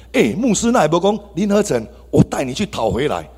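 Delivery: ordinary talk, nothing marked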